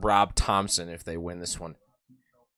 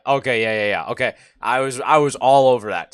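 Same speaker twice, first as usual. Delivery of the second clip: excited; 3 semitones higher, 7 dB louder than usual. Real, not acted. Excited to have guessed right.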